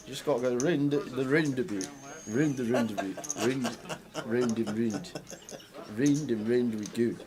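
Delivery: mock Scottish accent, rolling the r; a Londoner's attempt at Scottish. Shown as a demonstration. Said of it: "I can do a good Scottish accent".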